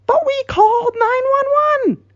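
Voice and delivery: very high, squeaky objection; protesting squeal. Acted. Crystal contradicting the confession, protesting squeal. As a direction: pipe up in an extreme falsetto.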